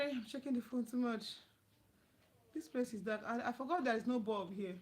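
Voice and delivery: ordinary delivery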